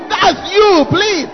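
loud, high preacher's plea; shouted, hammed-up exasperation; exasperated pleading. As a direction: beg them at full voice to drop the act. Preacher pleading against fake accents.

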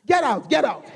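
high-pitched acted order to leave; angry father. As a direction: play a father throwing his child out. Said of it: "Your father is angry with you".

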